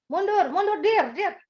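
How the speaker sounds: high, urgent parking directions; impatient call. Retold or imitated. Husband directing wife reversing the car, high and impatient. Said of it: "nggak sabar gitu ya".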